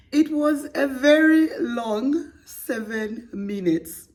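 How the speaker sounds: high, slow, laughing read. Real, not acted. Host reading the letter while cracking up. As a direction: struggle through it while laughing. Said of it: "Let's start again".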